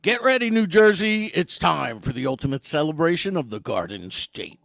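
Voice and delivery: big booming trailer announcer, loud; movie trailer voice. Shown as a demonstration. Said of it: "It's my movie trailer voice guy".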